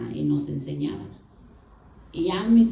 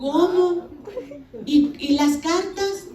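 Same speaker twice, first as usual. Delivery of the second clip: high, slowed incredulous question; retold astonishment; 9 semitones higher, much slower than usual. Husband learning his wife can't read, mock astonished. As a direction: imitate a husband taken aback, pitch raised.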